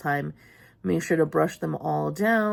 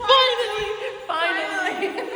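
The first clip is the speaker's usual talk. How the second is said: high laughing cry; triumphant shout. Real, not acted. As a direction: yell it in victory, laughing. Scare finally worked.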